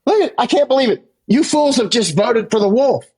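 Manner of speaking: exasperated disbelief. Retold or imitated. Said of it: "I'm sitting here going".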